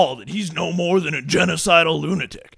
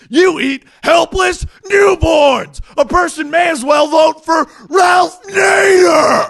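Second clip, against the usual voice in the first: higher, louder, slow indignant yelling; outraged shout; 11 semitones higher, 8 dB louder, slower than usual. Acted. TV host yelling at the candidate, slow and outraged. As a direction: blast him for eating newborns.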